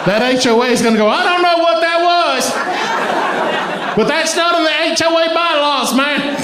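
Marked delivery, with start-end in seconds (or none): 1.1-6.4 high, mock-outraged complaint; indignant HOA member. HOA reacting to a strange name yelled, mock indignation. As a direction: play a baffled, protesting neighbour.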